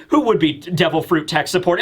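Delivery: normal talking voice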